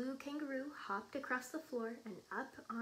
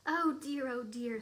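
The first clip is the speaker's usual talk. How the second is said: dismayed; 9 dB louder than usual. A narrator's reading. Kangaroo's dismayed thought, voiced aloud.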